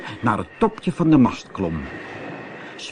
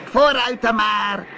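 high witch voice, surprised; sea witch calling; 11 semitones higher than usual. A narrator's reading. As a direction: ask it like a curious witch. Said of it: "riep de zeeheks".

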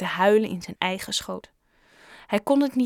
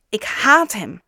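louder fierce outburst; 2 semitones higher, 7 dB louder, slower than usual. A narrator's reading. Boy tells Raj he hates his father, fierce. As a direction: spit it out with real heat. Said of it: "zei Joe fel".

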